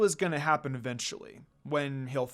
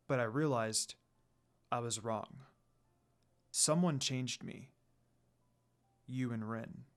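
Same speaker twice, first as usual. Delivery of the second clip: lower, softer lord confessing his love; a reserved lord opening his heart quietly; tender confession; 5 semitones lower, 6 dB quieter than usual. A narrator's reading. Sesshomaru's confession.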